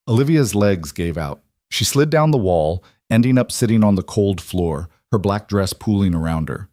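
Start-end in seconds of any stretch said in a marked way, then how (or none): none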